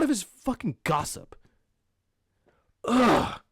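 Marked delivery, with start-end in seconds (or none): 2.8-3.4 exasperated "ugh"; disgusted groan. Real, not acted. Podcaster sick of gossip, disgusted groan.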